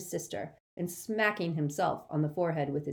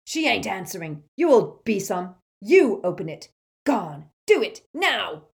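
rough demand; 4 semitones higher, 9 dB louder than usual. A narrator's reading.

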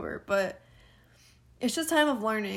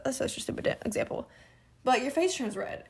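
ordinary talk

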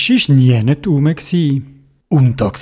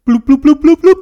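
bubbling sounds; 10 semitones higher, 6 dB louder, faster than usual. A narrator's reading. Bubbles rising underwater.

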